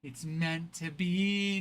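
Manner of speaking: normal talking voice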